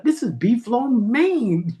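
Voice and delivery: high, slow mock indignation; upset. Customer upset over the misread lid, high wounded protest. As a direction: stretch it out in wounded disbelief. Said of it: "I was upset".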